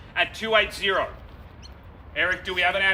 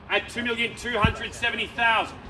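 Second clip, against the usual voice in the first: ordinary talk, nothing marked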